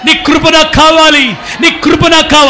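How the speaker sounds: loud pleading prayer cry; loud fervent begging prayer; shouted prayer. Shown as a demonstration. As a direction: pour out the plea at full voice. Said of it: "ఒక్కసారి గట్టిగా".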